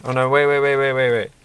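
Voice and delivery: sudden alarmed halt while building; urgent wait. Real, not acted. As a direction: stop yourself in a rush. Catching a mistake.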